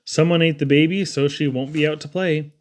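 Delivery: ordinary delivery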